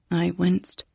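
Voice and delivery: plain reading voice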